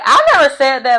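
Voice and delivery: lightly acted protest of innocence; mock indignant denial. Recapping the man's believable denial at the table, mock indignant. Retells Andrew's convincing denial.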